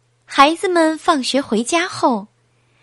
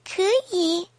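put-on small, high baby reply; little baby piping up; 8 semitones higher, 4 dB quieter than usual. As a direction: answer in a tiny high voice. Baby answers from the belly.

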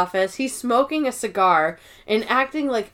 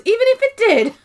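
high flustered backpedal; 12 semitones higher, 5 dB louder, faster than usual. Retold or imitated.

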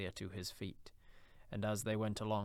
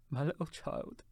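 higher, tearful cry of grief; breaking down; 5 semitones higher than usual. A narrator's reading. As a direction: let the voice break into sobbing. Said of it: "he broke down all at once".

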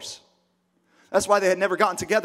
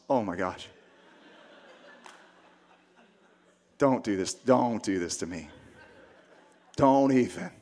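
very low, pleading dismay; acted shock, voice dropped right down; stunned disbelief; 9 semitones lower, 4 dB quieter than usual. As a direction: plead low and softly, with long pauses. Acting Joseph hearing the pregnancy news.

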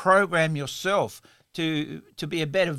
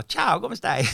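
higher, joking switch into Italian; playful Italian; 4 semitones higher, 3 dB louder, slower than usual. Pretend. Host jokes he'll speak Italian for a while, playful Italian. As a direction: greet playfully in an Italian accent. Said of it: "I'll speak Italian for a while".

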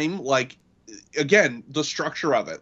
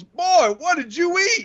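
much higher, loud put-on voice; Tom Arnold impression; 4 dB louder, slower than usual. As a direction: squeal it out in disgust. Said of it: "Tom Arnold sees a dead body and goes".

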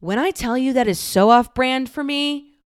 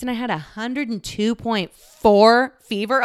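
ordinary talk

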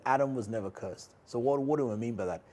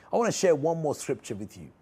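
ordinary delivery

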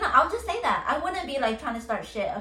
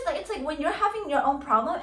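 usual speaking voice

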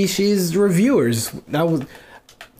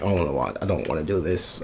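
softer, lower, dragging reluctance; reluctant mumble; 10 semitones lower, 6 dB quieter, faster than usual. Retold or imitated. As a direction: grudgingly give in, half under breath. Reluctant after coin flip.

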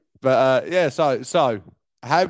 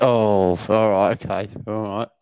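deflated, pitch sinking low; underwhelmed, grudging agreement; unenthused; 9 semitones lower than usual. Real, not acted. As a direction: let the enthusiasm drain into a low, resigned acceptance. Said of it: "you don't sound so enthused".

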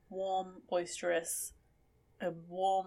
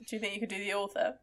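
ordinary talk, nothing marked

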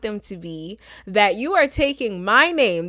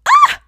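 short, loud, very high yelp; high shriek; 13 dB louder than usual. Acted. Reacting to accent snobs in a mock outburst, high shriek. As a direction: let out one sudden piercing "Ah!". Acted reaction inside quoted thought.

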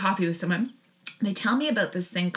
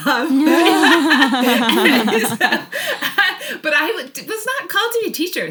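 laughing; 8 semitones higher, 7 dB louder, slower than usual. Real, not acted. Laughing about not being called.

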